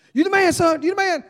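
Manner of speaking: high, loud excited hollering; cheering dad. Acted. Dad cheering his son round the ride, exuberant. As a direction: whoop it out to a kid riding past.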